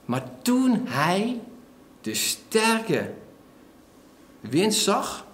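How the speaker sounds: slow, weighty reading of the verse; slow dramatic reading. Shown as a demonstration. Preacher slowly rereading the verse to the congregation, dramatic. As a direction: linger on each phrase to let it sink in. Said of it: "Toen zegt Matthäus".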